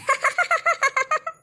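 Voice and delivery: high mocking cackle; long gloating ha-ha burst; crazy laughter. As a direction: laugh madly to taunt your sister. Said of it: "எதுக்கு இந்த mental இப்படி சிரிச்சிட்டு கிடக்கு".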